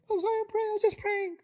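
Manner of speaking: high, meek apologetic voice. Shown as a demonstration. Young person apologizing to family, meek and high. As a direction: shrink back and apologize. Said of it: "No rise of voice, no lowering of voice".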